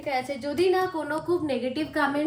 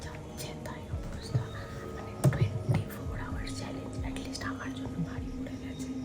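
hushed whisper in the dark; 5 dB quieter than usual. Real, not acted. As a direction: whisper so no one wakes up. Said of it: "আর আস্তে আস্তে কথা বলছি।". (ফিসফিস করে বলা)

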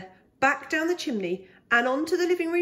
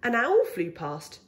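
plain reading voice

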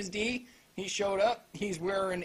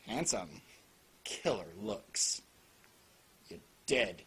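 much lower and softer, slow and deadpan; brooding hero voice; 11 semitones lower, 6 dB quieter than usual. Pretend. Reviewer mocking the hero's handsome cool, deep and slow. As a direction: growl it like a too-cool anime hero.

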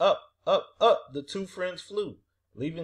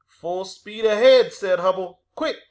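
loud, higher launch command; urgent order; 4 semitones higher, 9 dB louder than usual. A narrator's reading. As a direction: call out the order to race off. Hubble urgently orders departure.

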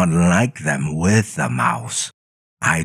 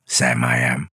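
plain reading voice

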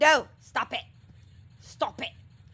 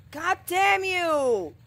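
louder slow curse; 7 semitones higher, 4 dB louder, slower than usual. Real, not acted. Cursing louder, drawn out.

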